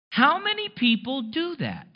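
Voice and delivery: normal talking voice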